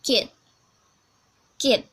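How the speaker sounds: ordinary talk, nothing marked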